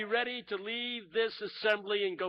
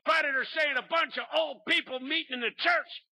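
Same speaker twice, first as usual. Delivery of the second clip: shouted preaching; 6 semitones higher than usual. Retold or imitated. Pastor preaching hard to the congregation. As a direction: drive it home to the crowd. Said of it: "He took off".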